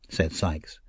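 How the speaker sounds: ordinary delivery, nothing marked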